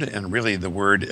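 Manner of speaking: ordinary talk, nothing marked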